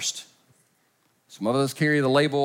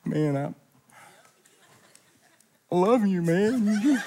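lightly voiced drunk lover; 4 semitones higher, slower than usual. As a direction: lean in like a tipsy friend. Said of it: "the lover, they look at you and they go".

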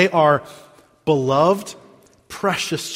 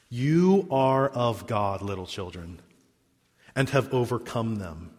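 tender reading; 5 semitones lower, 6 dB quieter than usual. Shown as a demonstration. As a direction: read it softly, like comfort to children. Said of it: "As I read verse four".

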